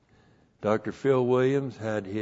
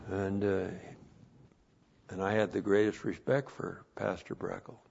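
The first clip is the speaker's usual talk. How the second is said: ordinary talk, nothing marked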